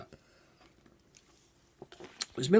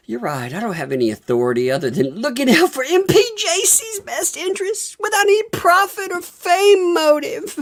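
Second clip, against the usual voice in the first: much higher, louder, fast and laughing; mock self-righteous reply; 4 dB louder than usual. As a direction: parody a smug denial through giggles. Mocking the Jokalist's reply.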